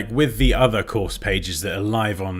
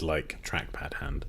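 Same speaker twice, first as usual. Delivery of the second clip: whisper; 5 semitones lower, 11 dB quieter, slower than usual. Real, not acted. Aside while holding the cat.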